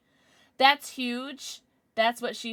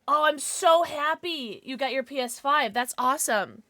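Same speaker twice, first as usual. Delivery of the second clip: ordinary talk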